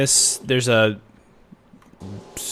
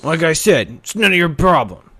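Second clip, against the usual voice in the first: bully snapping; 6 semitones higher, 4 dB louder than usual. Acted.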